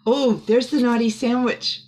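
plain reading voice

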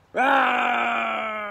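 loud, high drawn-out roar; bear bellow. Acted. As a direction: bellow from the pit. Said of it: "and bellowed".

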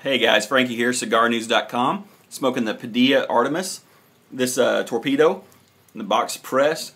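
normal talking voice

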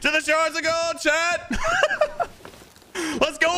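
high-pitched, drawn-out hype call; rally the crew with gusto; excited rallying cry. Real, not acted. Streamer hyping up chat for the shores of gold, excited rallying cry.

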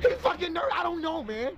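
high, agitated burst; panicked. Acted. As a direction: blurt it out in rising panic. Panicked phone call about body.

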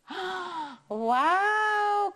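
high, amazed wow; delighted wow. Acted. Teacher amazed the tortilla escaped, high wow. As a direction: sound amazed.

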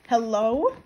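loud hello, swooping up at the end; loud, incredulous-sounding greeting; loud questioning call. Acted. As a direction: call it out with a sharp rising question. Character's chat message read in voice.

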